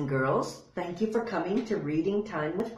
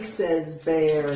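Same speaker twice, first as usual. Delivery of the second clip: usual speaking voice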